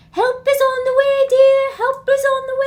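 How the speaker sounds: high, loud, hammed-up dame's trill; silly high-pitched accented call; Mrs. Doubtfire. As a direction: belt it out high like a jolly housekeeper. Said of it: "I'm hearing Missus Doubtfire".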